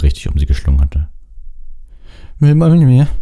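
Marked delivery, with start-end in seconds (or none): 2.4-3.1 blurted out, higher and louder; Harry blurting his question to Cho. Acted. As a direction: rush the words out nervously.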